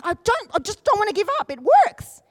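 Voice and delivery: high, pleading determination; stubborn insistence. Retold or imitated. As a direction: build to a loud final punch. Retells refusal to give up.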